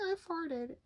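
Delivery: high, goofy pretend voice; silly girl voice. Voicing the girl on the vase, high silly confession. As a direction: voice the drawn girl confessing, playful.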